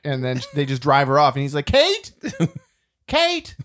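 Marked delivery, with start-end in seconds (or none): 1.6-3.5 high-pitched mock calling out; imitation of a desperate yell, much higher; retold yelling after someone. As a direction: call plaintively after a car pulling away, hammed-up. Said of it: "he's still yelling to her".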